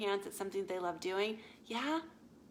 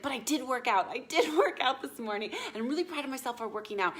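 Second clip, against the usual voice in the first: louder, higher, eager mock boast with a laugh; imitating proud client; 4 semitones higher, 6 dB louder, faster than usual. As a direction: play the client bragging about her workout. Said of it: "She's like".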